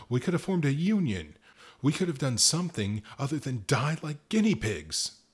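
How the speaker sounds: rising to a loud, high furious shout. A narrator's reading. Worker yelling at boss who stayed silent, furious. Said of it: "My temper flared like a stoked furnace".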